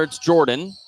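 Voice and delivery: ordinary talk, nothing marked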